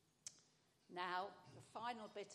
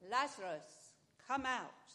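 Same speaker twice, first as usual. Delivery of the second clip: a ringing call, much louder, as Jesus at the tomb; 3 semitones higher, 10 dB louder than usual. Acted. Preacher voicing Jesus calling Lazarus from the tomb, loud ringing call. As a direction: call him out of the tomb with full voice. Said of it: "Jesus called out in a loud voice".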